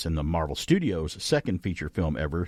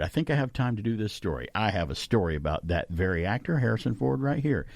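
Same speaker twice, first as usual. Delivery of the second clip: usual speaking voice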